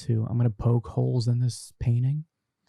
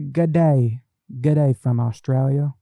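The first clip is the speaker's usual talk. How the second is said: Aussie greeting; 2 semitones higher, 5 dB louder than usual. Retold or imitated. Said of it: "we got Treasure Hunter Australia".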